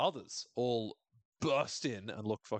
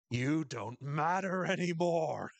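slow, higher and louder creepy line; eerie Lottie; 5 semitones higher, 3 dB louder, slower than usual. Retold or imitated. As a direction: intone it like a possessed seer. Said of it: "Lottie is all like".

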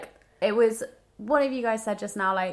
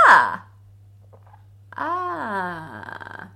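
creaking groaning door voice. Pretend. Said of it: "Sound like a creaking door".

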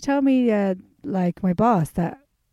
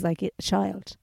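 usual speaking voice

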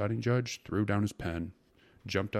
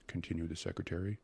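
ordinary delivery

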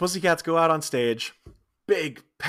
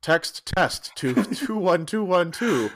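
mock ad read; 4 semitones higher, slower than usual. Mock eugenics commercial read.

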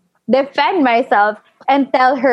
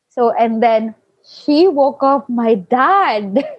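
ordinary delivery, nothing marked

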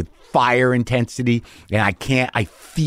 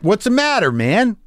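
retold challenge, pitched up high; agitated challenge; 10 semitones higher than usual. Challenging the comic who walked by, agitated. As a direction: confront him in a higher, exasperated voice.

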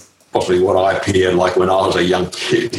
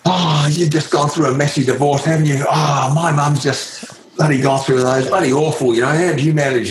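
put-on higher, eager young American voice; chatty kid prying, all innocence; young Lance Armstrong; 8 semitones higher than usual. Young Lance quizzing his new teammate, eager and nosy. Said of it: "he said".

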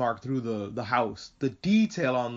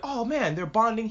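high-pitched, delighted gush; gushing reaction; 6 semitones higher than usual. Retold or imitated. Retelling their reaction to the friends bonding, gushing. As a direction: gush over a sweet moment, pitched high.

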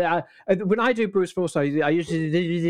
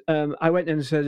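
ordinary talk

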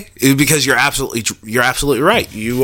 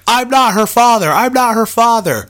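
mock denial; 11 semitones higher, 5 dB louder than usual. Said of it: "he is like".

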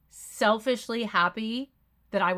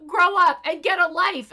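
higher, louder snapping retort; mock angry yell; 7 semitones higher, 6 dB louder than usual. Jill yelling at Kathy, hammed-up angry retort. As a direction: bark it like a furious retort. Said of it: "And then she goes".

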